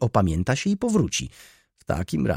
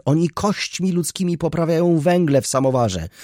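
high, loud, near-hysterical tirade; exclaimed plea; 7 semitones higher, 5 dB louder than usual. A narrator's reading. Baroness begging to have the nihilists evicted, exclaiming. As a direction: plead with mounting outrage. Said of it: "zawołała, składając pobożnie ręce".

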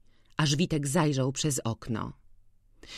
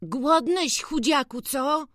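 put-on much higher boyish voice, tender; boy talking to dog; 11 semitones higher, slower than usual. A narrator's reading. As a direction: coax the thin dog kindly.

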